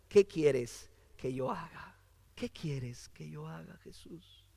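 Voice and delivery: low, very soft plea fading to a whisper; hushed submission. Acted. Preacher voicing Saulo's question to Jesus, hushed. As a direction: ask it humbly, dropping almost to a whisper. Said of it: "¿qué dijo Saulo?".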